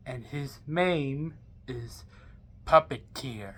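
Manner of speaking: name the dark creation with dread; ominous reveal. Acted. Villain revealing the name of the evil he will summon, low ominous hush.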